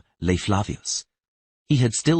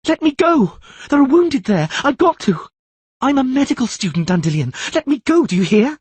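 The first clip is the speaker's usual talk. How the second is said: shrill, much higher and louder panicked screaming; hysterical screaming; 6 dB louder than usual. Acted. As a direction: scream to break free, frantic. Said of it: "was screaming hysterically".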